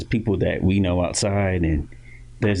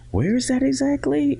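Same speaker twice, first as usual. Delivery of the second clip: high-pitched confused asker; mock puzzled question. Strangers asking where his hometown is, in a high mock-puzzled voice. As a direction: squeak it out like a baffled stranger. People asking about Titusville.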